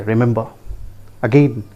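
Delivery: ordinary talk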